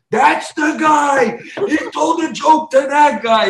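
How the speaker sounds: loud, high, drawn-out exclamation; insistent shout. Retold or imitated. Retelling his argument with his co-host, insistent high shout. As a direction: shout it in comic exasperation.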